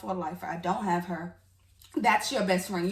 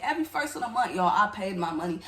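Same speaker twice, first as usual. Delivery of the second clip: ordinary talk, nothing marked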